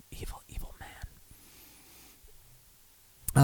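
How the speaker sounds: hushed, whispered mock resentment; muttered grudge. Host blaming Scrams for the unfriending, whispered grudge. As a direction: whisper it darkly under your breath. Muttering about the friend to blame.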